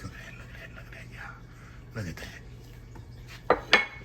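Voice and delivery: soft awed whisper. Real, not acted. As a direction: hush in awe of the meat. Marvelling at cut chicken.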